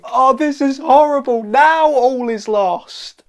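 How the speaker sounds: high, loud, drawn-out cry; hammed-up despair; despairing wail. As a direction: wail as if all hope is gone. Acted pig despairs loudly.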